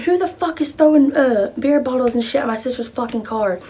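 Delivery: high, loud angry yell; retold scream. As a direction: scream it out at the crowd. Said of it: "I started screaming".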